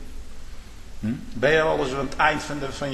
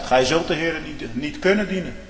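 ordinary talk

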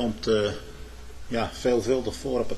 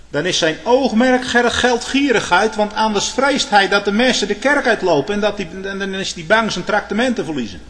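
raised, high preaching voice; fel prekend; 8 semitones higher, 7 dB louder than usual. Real, not acted. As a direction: denounce it loudly from the pulpit. Preacher denounces greedy ministers. (met luide, hoge stem aanklagend)

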